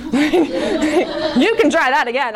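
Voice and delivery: laughing defiance. Retold or imitated. Defiant retort to the dean.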